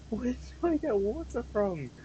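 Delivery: whiny high repeat. Acted. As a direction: whine it like a helpless kid.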